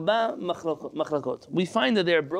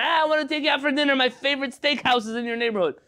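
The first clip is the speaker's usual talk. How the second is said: high-pitched, eager mock invite; acting out an enthusiastic host; eager acted invitation; 7 semitones higher than usual. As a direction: invite a friend along, pitched well up. Acted invitation to a vegan friend.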